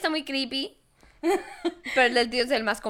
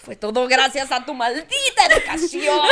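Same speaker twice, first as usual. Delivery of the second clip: laughing mock blame; 5 dB louder than usual.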